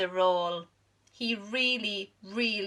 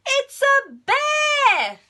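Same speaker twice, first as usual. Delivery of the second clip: very high, loud, slow alarm; panicked shout; 12 dB louder, much slower than usual. Acted. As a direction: warn everyone in terror. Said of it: "they cried".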